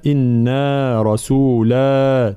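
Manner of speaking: إنا رسولا recited slowly with the final alif held; measured tajweed إنا رسولا; dual madd. Shown as a demonstration. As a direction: draw out the last vowel of رسولا. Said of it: "دائمًا مثنى هنا".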